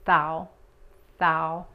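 "thou" with the tongue between the teeth; TH demo. Shown as a demonstration. Teacher isolating the TH of "thousands". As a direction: stress the TH in "thou". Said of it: "pronunciar la T H".

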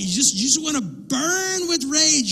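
high-pitched, worked-up fury; acted rage. Acting out burning rage.

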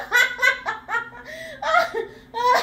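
helpless giggling fit; hysterical laugh. Real, not acted. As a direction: laugh until you can't stop. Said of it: "[name] laughing spell".

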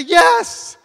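high, very loud mock-enthusiastic shout; exaggerated yes. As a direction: yell it back to the excited bride. Acted exaggerated answer to bride.

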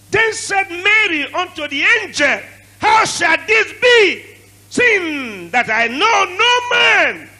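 high, slow shouted preaching; full-force preaching. Acted. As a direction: shout the verse out slowly.

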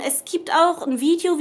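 ordinary talk, nothing marked

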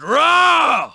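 loud fighting roar; effort roar. Acted. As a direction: roar as you throw a punch. Prefect grunts while fighting.

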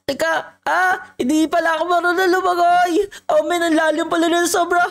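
high-pitched panic while drowning; panicked swimmer. Acted. Acted panic, cannot swim, drowning. (natatarantang nalulunod, mataas ang boses)

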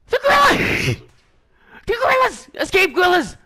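high, loud shouts of delight; excited yelling. Real, not acted. Player cheering on escaped gorillas, excited shouting. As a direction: yell it out, wildly thrilled.

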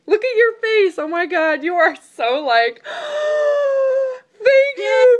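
high, choked-up gasp into thanks; overwhelmed and moved, voice cracking high; real tearful gasp of joy; emotional, near tears. Real, not acted. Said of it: "Why do I get so emotional about this?".

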